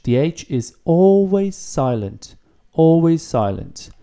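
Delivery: "always" hit and drawn out; stress on always. Shown as a demonstration. Teacher insisting to students the H is always silent. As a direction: press hard on "always" both times. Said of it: "stressing the silent H".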